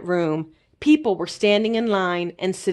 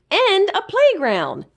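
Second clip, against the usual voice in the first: high, bright-eyed little critter voice; high eager sidekick calling a friend; Zeke the little creature; 11 semitones higher than usual. A narrator's reading. Zeke's voice in the story.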